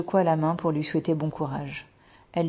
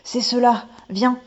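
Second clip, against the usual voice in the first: excited exclamation; 7 semitones higher, 6 dB louder than usual. Acted. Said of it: "il s'écria".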